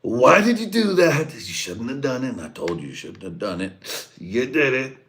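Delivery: very high, loud mock scold; mock scolding Trump. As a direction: scold the ally like a boss. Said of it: "Trump was like".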